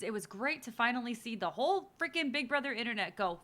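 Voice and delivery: normal talking voice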